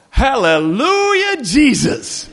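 loud, high, drawn-out hallelujah cry; mock evangelical shout. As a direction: belt it out slowly like a fervent evangelical. Retold full-on evangelical's delighted cry.

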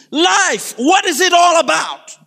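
high, loud declaiming shout; theatrical scream from the crowd; screamed skit line. Acted. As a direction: cry it out dramatically, one burst after another. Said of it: "another person screams".

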